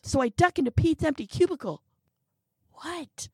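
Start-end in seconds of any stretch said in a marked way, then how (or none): 2.7-3.3 hissed, urgent whisper; whisper shout. A narrator's reading. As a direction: shout it under your breath. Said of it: "I whisper shout".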